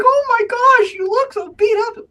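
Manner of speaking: squealed way up high; high gushing coo; fawning girls. Retold or imitated. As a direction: fuss over the bruised boy. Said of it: "They're like".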